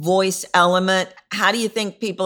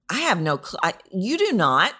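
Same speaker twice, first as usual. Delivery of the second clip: ordinary talk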